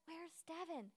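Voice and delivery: soft, hushed call; hushed search. Retold or imitated. Head counselor searching the dark cabin, hushed. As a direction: ask softly in a room of sleepers.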